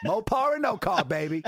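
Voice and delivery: a slogan tossed back with a laugh; laughing comeback. Retold or imitated. Husband riffing back at his wife, laughing. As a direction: laugh it out like a car ad tagline.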